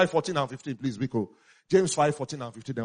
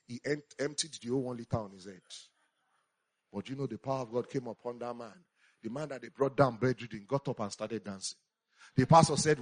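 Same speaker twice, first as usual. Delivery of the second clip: ordinary delivery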